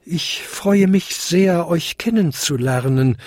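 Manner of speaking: high-pitched courteous little bell; tinkling little bell. A narrator's reading. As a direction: greet them in a small, high bell voice. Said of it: "klingelte die kleinste Glocke".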